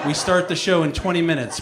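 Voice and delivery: normal talking voice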